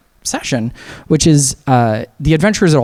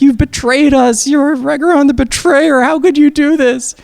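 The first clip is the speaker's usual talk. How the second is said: playing it as a wounded, weepy cry; tearful accusation; 12 semitones higher, 3 dB louder than usual. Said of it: "Rose is literally in tears crying".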